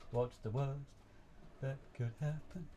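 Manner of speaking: drawn-out slogan with a rising end; Doctor Pepper slogan. Acted. Quoting the advert before a risky run, teasing slogan delivery. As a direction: pause after "worst", then lift "happen". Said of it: "Doctor Pepper moment".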